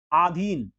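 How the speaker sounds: आधीन opening on a long "aa"; wrong long a. Shown as a demonstration. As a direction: stretch the first vowel of आधीन. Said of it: "आधीन नहीं होता है".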